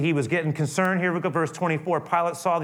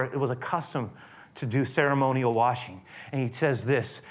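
normal talking voice

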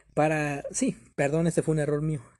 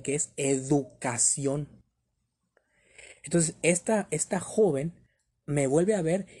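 usual speaking voice

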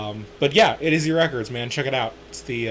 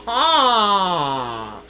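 put-on Valley Girl; 4 semitones higher than usual. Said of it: "is that Valley Girl".

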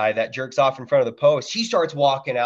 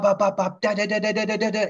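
higher-pitched mouth gunfire bursts; imitated gunfire; 10 semitones higher, faster than usual. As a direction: rattle off the shots like a machine gun.

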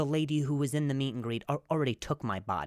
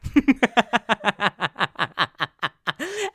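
loud laugh; 8 semitones higher, 9 dB louder than usual. Real, not acted.

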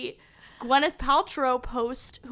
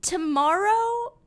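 ordinary talk, nothing marked

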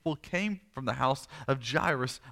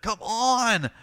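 high, insistent drawn-out plea; impatient urging; 7 semitones higher, 4 dB louder than usual. Acted. Father trying to pull Jesus along, impatient urging. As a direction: tug them along with the voice. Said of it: "trying to pull Jesus along".

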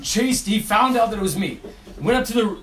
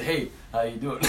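soft, lower, sheepish hello; innocent greeting; 7 semitones lower, 9 dB quieter than usual. Retold or imitated. Student greets the angry dean, sheepish and soft. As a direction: play it innocent, under your breath. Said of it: "I said".

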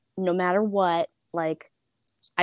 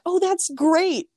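bright accepting parent; 12 semitones higher, 4 dB louder, slower than usual. Retold or imitated. Imagined accepting parents' reply.

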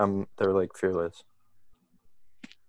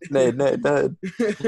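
grenade warning yell; 7 semitones higher, 3 dB louder, much slower than usual. Acted. Calling out to teammate in game.

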